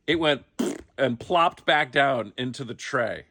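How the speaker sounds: ordinary delivery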